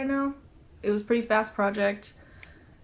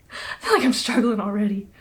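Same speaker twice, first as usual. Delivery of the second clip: winded and puffing; out of breath; 3 dB louder than usual. Real, not acted. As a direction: talk while short of breath. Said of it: "the girl's winded".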